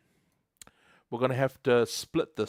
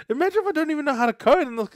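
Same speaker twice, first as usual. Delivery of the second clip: excited joke; 13 semitones higher, 5 dB louder, much faster than usual. Real, not acted. Joking about AI writing code.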